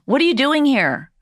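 mock scolding. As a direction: shoo them out mockingly. Mocking the awing audience member.

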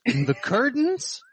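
high, slow put-on voice; whiny prince. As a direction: ask about the curtains in a high, drawn-out voice. Prince's line from Monty Python.

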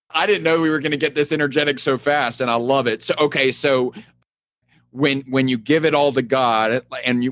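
usual speaking voice

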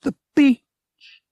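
high, loud, thrilled cry; excited exclamation. A narrator's reading. Woman spotting the sea from the air, excited cry. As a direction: exclaim it with sudden delight. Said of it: "to give Han an excited smile".

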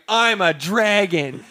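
slow, high mock dragon voice; hammed-up dragon line. First take of the line.

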